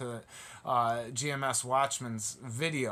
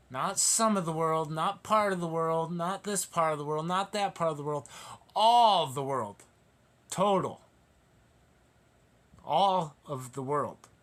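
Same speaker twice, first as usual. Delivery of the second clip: fiery preaching; 4 semitones higher, 5 dB louder than usual. Acted. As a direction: pound each contrast home. Preaching at full force.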